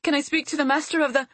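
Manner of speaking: high, rushed, anxious request; urgent plea. A narrator's reading. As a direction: hurry it out before the door shuts. Hurried request cut off by door.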